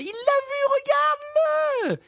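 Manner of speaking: very high, slow, drawn-out cry; excited squeal of a shout; exclaiming. A narrator's reading. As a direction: urge a friend to look, voice soaring. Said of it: "s'exclama Harry".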